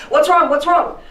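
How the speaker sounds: high, loud urgent questioning; alarmed mother. Acted. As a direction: burst in shouting with alarm. Acts mother rushing in alarmed.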